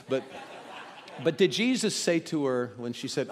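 usual speaking voice

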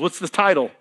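ordinary delivery

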